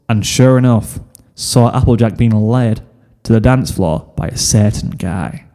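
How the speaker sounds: usual speaking voice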